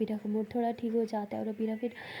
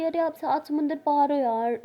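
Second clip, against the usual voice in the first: playful protest; 4 semitones higher, 6 dB louder than usual. Acted. Teasing protest to friend.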